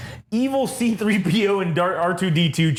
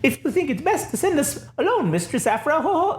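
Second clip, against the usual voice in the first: put-on higher British accent, prim droid; 11 semitones higher than usual. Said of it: "ever welcoming British accent".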